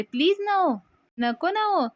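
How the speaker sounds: high, loud mimicry of pleading; mocking echo. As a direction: parrot the plea back in an exaggerated high voice. Aunt mockingly echoes the plea.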